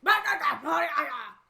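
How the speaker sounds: loud, harsh German-sounding nonsense; shouted parody of German; mock German gibberish. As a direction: bark out fake German syllables. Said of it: "and then there's German".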